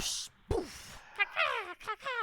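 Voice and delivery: high squeaky nonsense sounds; silly high creature noises. Pretend. Playing bear-transformed princess.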